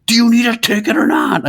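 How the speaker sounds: impatient high mocking voice of the old man; angry old attendant. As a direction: bark it like a cranky attendant.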